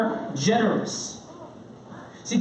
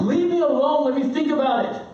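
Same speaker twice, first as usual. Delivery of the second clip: raised-pitch exasperated dad; 6 semitones higher than usual. Retold or imitated. Exasperated dad puts off daughter, high mock outburst. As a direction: act out losing patience with a pestering kid. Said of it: "if I'm really exasperated".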